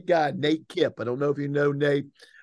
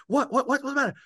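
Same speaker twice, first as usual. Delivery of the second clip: alarmed; 9 semitones higher, faster than usual. Retold or imitated. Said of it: "He's like".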